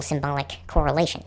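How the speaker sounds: normal talking voice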